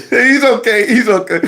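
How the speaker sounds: high, put-on cheerful cover-up; fake-smile reassurance. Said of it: "the fake smile".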